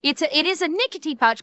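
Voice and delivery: usual speaking voice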